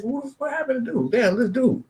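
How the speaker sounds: high, startled exclamation; stunned reader. Retold or imitated. Reading the article about the freed kid, high stunned exclamation. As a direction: gasp it out in disbelief.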